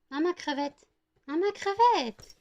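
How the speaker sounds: high stretched coo at the dog; doting dog owner in a very high, slow coo. Real, not acted. Baby talk to the dog.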